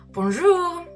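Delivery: ordinary talk, nothing marked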